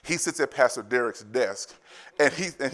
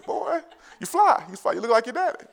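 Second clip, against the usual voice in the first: high proud praise; 10 semitones higher, 5 dB louder than usual. Retold or imitated. Dad praising son.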